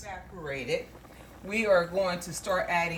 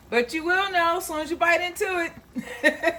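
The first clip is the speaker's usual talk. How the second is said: much higher and louder, thrilled promise; excited hype; 12 semitones higher, 5 dB louder than usual. Real, not acted. Cook promising viewers the taste, high and excited. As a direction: sell the first bite with glee.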